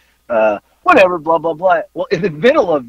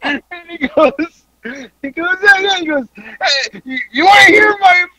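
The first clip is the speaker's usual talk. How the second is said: high, squeaky, laughing nearly to tears; helpless laughter; 11 semitones higher than usual. Real, not acted. Retelling the fan's line to a friend, cracking up. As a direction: struggle to get the words out through laughter. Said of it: "I can't even get it out".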